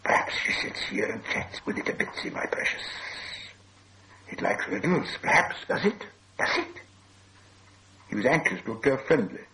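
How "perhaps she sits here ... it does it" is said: hissing, whispery creature, coaxing; Gollum turning polite. A narrator's reading. Gollum turns polite, talking to himself.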